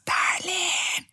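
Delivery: very high, airy cry of a pet name; breathless joyful cry. Retold or imitated. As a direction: gasp it out mid-run, overjoyed. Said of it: "corriendo a toda velocidad". (grito agudo y sin aliento, corriendo de alegría)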